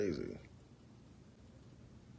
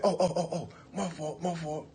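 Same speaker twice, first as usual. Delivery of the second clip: sheepish apology; 9 semitones higher than usual. Retold or imitated.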